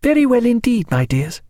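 high, affectionate woman's reply; hostess greeting children. A narrator's reading. Hostess replying to little girls as she kisses them, high greeting. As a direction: start very high, then come down on the endearment. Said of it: "she replied, kissing them".

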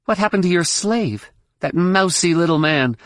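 high-pitched demand; high, pointed challenge; challenging. A narrator's reading. Jaina questions the villain.

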